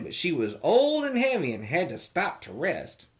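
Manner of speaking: ordinary delivery, nothing marked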